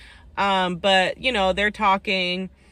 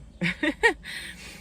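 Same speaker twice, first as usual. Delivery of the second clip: short laugh; 9 semitones higher, 4 dB quieter than usual. Real, not acted. Laugh after her opinion.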